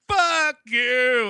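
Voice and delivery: high, loud action-hero shout; hammed-up movie tough-guy yell; The Rock. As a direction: bellow it defiantly in character. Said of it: "of course, The Rock's like".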